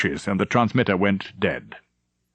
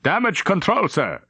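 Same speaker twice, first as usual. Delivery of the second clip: a higher call of damage control; officer shouts up to the captain, a higher call; shouted report; 9 semitones higher than usual. Acted. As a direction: call it out across the bridge. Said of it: "Frober shouted".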